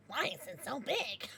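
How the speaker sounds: through helpless laughter; wheezing laugh. Real, not acted. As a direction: crack up while asking. Repeats line, laughing hard.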